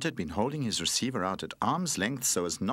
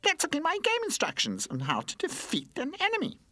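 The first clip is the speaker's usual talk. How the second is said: very high, bright toy voice; Charlie Chip calling out. Acted. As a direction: call out the plan. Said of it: "called Charlie Chip".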